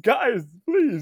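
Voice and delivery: loud, high-pitched begging; pleading at full volume; exasperated plea. Retold or imitated. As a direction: beg them, pushing pitch and volume up. Acted plea to trailer makers.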